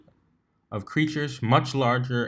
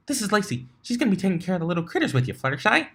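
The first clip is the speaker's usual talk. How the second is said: high, fast bubbly chatter; eager interruption; 9 semitones higher, much faster than usual. Acted. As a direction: rush it out gleefully.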